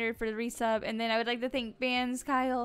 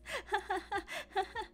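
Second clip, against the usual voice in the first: high laugh; 10 semitones higher, 5 dB quieter than usual. Real, not acted. Laughing at chat joke.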